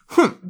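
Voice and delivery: loud, high offended huff; short, affronted snort; offended humph. A narrator's reading. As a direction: burst out with an indignant humph. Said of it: "lets out an offended".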